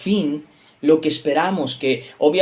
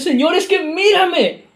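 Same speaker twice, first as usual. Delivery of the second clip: desperate plea; 8 semitones higher than usual. Acted. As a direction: beg God as if at the end of your rope. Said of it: "le dices".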